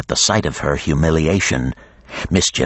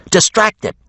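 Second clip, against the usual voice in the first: much higher, louder call; yell; 3 dB louder than usual. A narrator's reading. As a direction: shout across the deck. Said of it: "she yelled".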